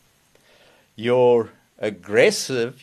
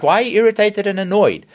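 quick, higher and louder mock annoyance; irritated retort; 8 semitones higher, 6 dB louder, faster than usual. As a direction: fire back, a little put out. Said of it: "And it's like".

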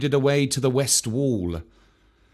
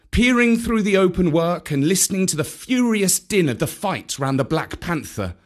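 ordinary delivery

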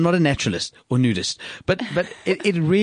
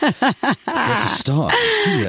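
theatrical announcement; 11 semitones higher, much slower than usual. Pretend. Echoing with a sung flourish.

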